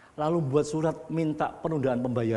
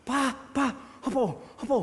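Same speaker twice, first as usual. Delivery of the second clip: high, gasping call for his father; breathless son calling; 11 semitones higher than usual. Retold or imitated. Son short of breath calling his father, high and urgent. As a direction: voice the panicked son, pitched up and half laughing.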